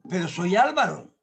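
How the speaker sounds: normal talking voice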